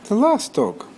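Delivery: put-on high, eager boy's voice; boy asking the shelter lady, high and eager; young George. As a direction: lift it up like a hopeful little boy. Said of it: "George said to the lady".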